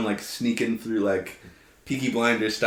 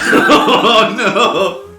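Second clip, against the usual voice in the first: laughing, loud and high; 11 semitones higher, 12 dB louder than usual. Real, not acted. Bad roll, player laughs.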